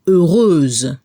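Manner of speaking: heureuse ending in a buzzing z; heureuse, end on the z sound; final Z heard. Shown as a demonstration. Teacher correcting the quiz answer. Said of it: "se debe escuchar la Z al final".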